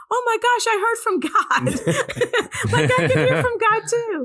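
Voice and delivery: very high giddy squeal, breaking into laughter; amazed elation. Retold or imitated. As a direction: relive the thrill, high and laughing. Retells amazed joy at hearing God.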